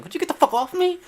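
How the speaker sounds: put-on high whiny kid voice; mock kid whine. Kid pushing off his hugging aunt, high whine. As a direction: whine it like an annoyed child.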